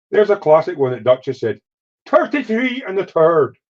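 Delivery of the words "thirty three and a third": "Thirty-three and a third" in a much higher, slow mock Irish accent. Quoting Dutch's classic line in a mock Irish accent. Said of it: "That's a typical Irish".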